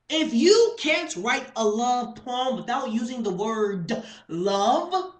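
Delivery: loud, high, emphatic slam poetry; theatrical indignation with stretched words; spoken-word rant. Acted. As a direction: hammer out the key words at full volume.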